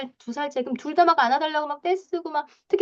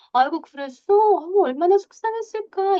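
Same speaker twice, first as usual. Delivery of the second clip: 다정하게 달래는 엄마 말투 시범, 높아지는 억양; 아이를 안쓰러워하듯 과장되게 다정히; 다정한 공감; 7 semitones higher than usual. Shown as a demonstration. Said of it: "이렇게 막 다정하게 말하는". (다정하게 공감하는 말투)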